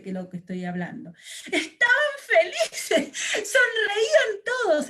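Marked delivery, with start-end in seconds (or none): 1.4-4.9 much higher, louder, laughing narration; beaming, laughing storyteller; joyful. Shown as a demonstration. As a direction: let the happiness burst through the words. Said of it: "Yo no estoy expresando con mi cara la felicidad".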